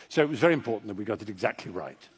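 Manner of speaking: usual speaking voice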